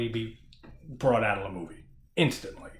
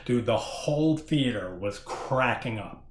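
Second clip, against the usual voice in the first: ordinary talk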